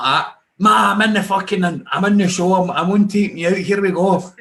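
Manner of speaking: high, loud, thrilled; over-the-moon shouting; excited. Retold or imitated. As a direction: yell it out like you just won. Retold joy at getting on show.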